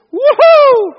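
high, loud, chipper holler; bright, cheerful whoop. Acted. Mocking a cheerful waking from sleep, bright whoop. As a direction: whoop like an over-cheery morning person. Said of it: "this isn't the bright, cheerful".

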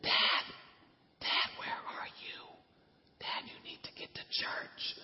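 urgent hushed whisper, slow. Retold or imitated. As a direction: whisper it so nobody in church hears. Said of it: "So she's whispering".

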